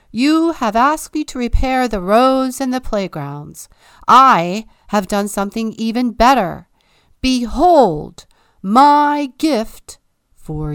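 loud, grand, slowing royal announcement; pompous proclamation. A narrator's reading. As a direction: proclaim it proudly from the throne. King presents his gift grandly.

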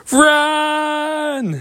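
very high, drawn-out cry; mock panicked cry. Girls fleeing the cage with flowers, high drawn-out cry. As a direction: sound the escape in a long high wail.

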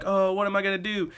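high-pitched mock panic; freaking out. Hitomi late for her next job, mock freak-out. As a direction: imitate a fretful wail, pitched well up. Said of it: "and then freaks out because".

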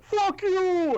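high, loud mock yell; explosive, drawn-out mocking shout; retold angry outburst. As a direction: yell it in a shrieked imitation of his outburst. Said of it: "He's like".

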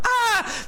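high hammed-up yelp of fright; a shepherd's mock panic cry, laughing; mock terrified scream. Pastor acting out the shepherds freaking out, mock scream. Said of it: "They're like".

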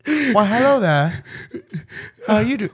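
mock British.

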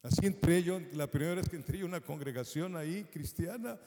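normal talking voice

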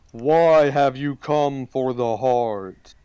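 slow, booming tree voice; talking tree. Acted. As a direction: question them like an ancient guardian. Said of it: "And the tree asks".

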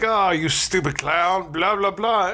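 slow, singsong-ish mock insult; mock bullying taunt. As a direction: imitate bullies taunting, half-heartedly. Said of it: "they start bullying Pennywise to death".

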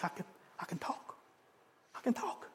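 hushed, barely voiced, rising in wonder; awed whisper. Healed mute man marvels at his own voice. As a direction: whisper it like you can't believe it.

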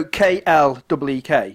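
slow, letter-by-letter spelling; spelled out. Shown as a demonstration. Correcting the co-host on a slang word, spelled out slowly. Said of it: "not, not clique, clique".

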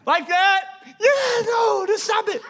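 high, squeezed mock yelling; hammed-up high-pitched squabble; exasperated family exchange. Loud child failing to whisper, parent relenting.

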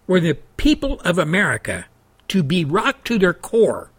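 usual speaking voice

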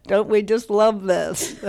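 real laughter through the words; laughing. Real, not acted. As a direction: say it laughing along with the audience. Laughs after finishing the poem.

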